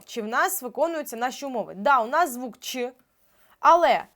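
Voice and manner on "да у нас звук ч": ordinary talk, nothing marked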